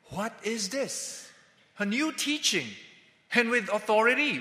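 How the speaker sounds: the crowd's astonishment, lifted up high; amazed. A narrator's reading.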